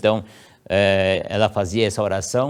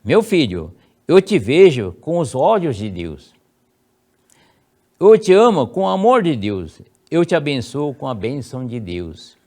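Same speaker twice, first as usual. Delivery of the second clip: prayer read aloud; 3 semitones higher, 6 dB louder than usual. Shown as a demonstration. Parent praying over a child at bedside, solemn blessing. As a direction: bless the sleeping son with each phrase. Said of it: "ela fazia a seguinte oração".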